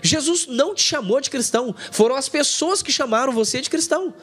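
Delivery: normal talking voice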